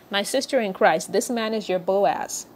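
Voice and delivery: ordinary delivery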